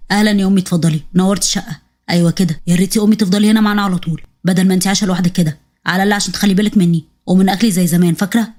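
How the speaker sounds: low, fond son welcoming his mother; low-pitched doting son; husband's voice. Acted.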